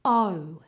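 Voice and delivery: O said as a glide from oh into oo; two-part vowel. Shown as a demonstration. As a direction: round the O off toward a W. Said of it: "Make sure you get the O and the U".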